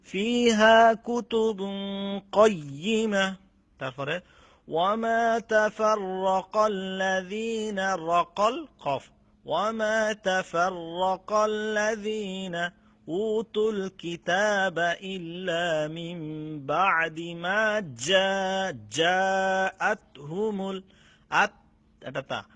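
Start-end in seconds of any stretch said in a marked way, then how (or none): 0.1-3.4 slow, higher, louder melodic tilawat; Quran recitation. Acted. Teacher reciting the verse for students, slow melodic chant. 4.7-21.5 slow, higher, louder melodic tilawat; Quran recitation. Acted. Teacher reciting the verse for students, slow melodic chant.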